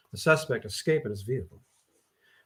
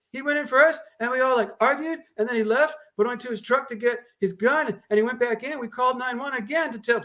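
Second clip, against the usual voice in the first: high, loud excited women's voices; agitated women; 9 semitones higher, 4 dB louder than usual. Acted. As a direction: rattle off details breathlessly.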